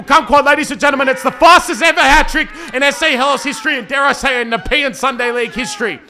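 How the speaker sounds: loud, high, roaring commentary on the record; big shouted hat-trick call; excited goal call. Real, not acted. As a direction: belt it out like the goal of the season.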